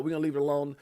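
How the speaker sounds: ordinary delivery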